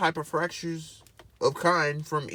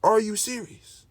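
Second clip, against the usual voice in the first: mock amazement; 4 semitones higher, 9 dB louder, slower than usual. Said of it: "And you gonna be like".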